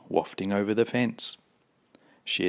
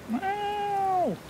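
very high, drawn-out meow; cat wail. A narrator's reading. Cat stuck on roof in rain, high wail. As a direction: wail like a distant cat, falling away.

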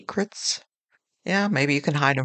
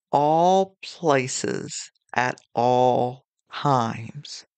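slow, spaced-out "all places at all times", leaning on each ALL; deliberate emphasis on ALL in "all places" and "all times"; stress on ALL; much slower than usual. Real, not acted. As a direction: stretch ALL both times, with pauses between the words. Correcting a dropped phrase, stressing both.

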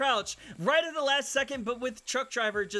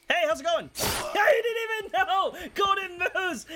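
loud, high, triumphant yelling at the caught player; gleeful shout; 9 semitones higher, 5 dB louder than usual. Real, not acted. Streamer catches a hiding player, triumphant shouting. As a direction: yell it like you just sprang the trap.

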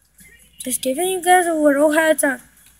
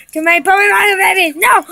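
rushed, raised panicked cry; play panic; 5 semitones higher, 4 dB louder, much faster than usual. Pretend. Player swarmed by puppy monkey babies, rushed panicked cry. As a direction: race through it and burst into a yell.

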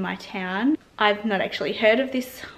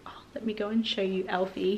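softer quick whisper so the dog stays asleep; 6 dB quieter, faster than usual. Real, not acted. To viewers before showing sleeping Alfie, whisper. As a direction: whisper it, creeping up.

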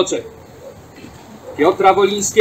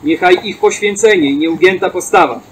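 ordinary talk, nothing marked

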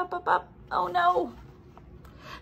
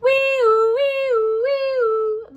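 high, loud wee-oo siren; siren wail; 11 semitones higher, 5 dB louder, much slower than usual. Acted. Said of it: "The sirens blare".

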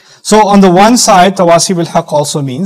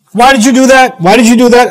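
put-on higher-pitched scolding of a child; acted telling-off, voice lifted; mock parental yelling; 6 semitones higher, 4 dB louder than usual. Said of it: "If I'm yelling at one of my kids".

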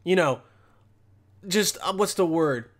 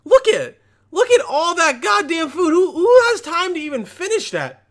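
higher and louder, gawking at the food; incredulous outburst; 9 semitones higher, 5 dB louder than usual. Real, not acted. Reacting to a video, loud amazed disbelief. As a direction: blurt it out in disbelief.

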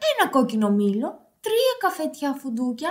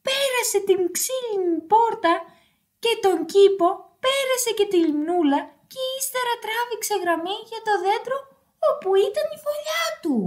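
high, hurried storytelling; racing narration; 6 semitones higher, faster than usual. A narrator's reading. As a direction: narrate the dash home, quickening and climbing in pitch. Excited narration of the race home. (γρήγορη, ψιλή αφήγηση)

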